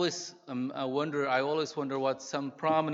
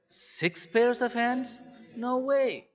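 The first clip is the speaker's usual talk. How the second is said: higher, slow disbelieving six pairs of hands; incredulous angel; 9 semitones higher, slower than usual. Acted. Angel doubting God's plan, high and incredulous. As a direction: stretch it out in amazement. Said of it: "The angel shook his head slowly".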